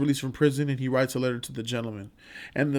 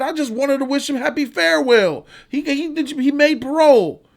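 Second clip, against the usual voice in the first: higher, louder mock menace; hammed-up would-be killer; mock inmate; 10 semitones higher, 5 dB louder than usual. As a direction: play the crazed inmate half-joking. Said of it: "He said".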